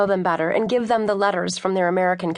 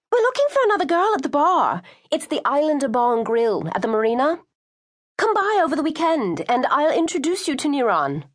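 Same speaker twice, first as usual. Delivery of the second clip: put-on Australian-London accent; 7 semitones higher than usual. A narrator's reading. Sophie offers bar job.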